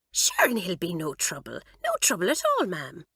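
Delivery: breezy Irish charm; Irish accent. A narrator's reading. Kitty calming Mamselle, lilting Irish. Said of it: "in an accent exactly like the one Doris had adopted".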